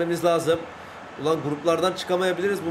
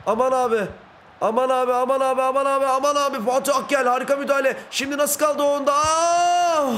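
high, panicky commentary ending in a cry; real alarmed yelling; 9 semitones higher than usual. Real, not acted. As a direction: yell in rising alarm at the danger. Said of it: "bağırdığım için özür diliyorum". (telaşla yükselen bağırış)

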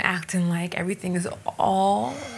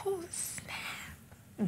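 teasing whisper; 7 semitones higher, 15 dB quieter than usual. Acted. Teasing after Gabe's comeback.

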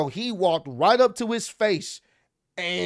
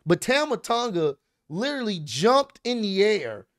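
ordinary talk

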